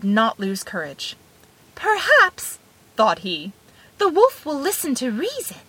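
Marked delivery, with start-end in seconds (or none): 1.7-2.6 high-pitched little character voice; little hero thinking. A narrator's reading. Tom Thumb thinking inside the wolf, high and hopeful. 4.0-5.6 lightly voiced small character, raised pitch; little hero thinking. A narrator's reading. Tom Thumb thinking about the wolf, hopeful.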